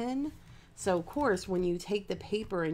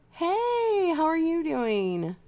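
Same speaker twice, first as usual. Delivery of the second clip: high, loud drawn-out hello; excited greeting; 11 semitones higher, 7 dB louder, slower than usual. Real, not acted. Greets a viewer joining live.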